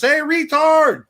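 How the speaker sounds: high, loud, slow jeering yell; mock drive-by shout. Stranger yelling at the governor's house, loud slow jeer. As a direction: bellow it out a car window. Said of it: "rolls down the window and shouts".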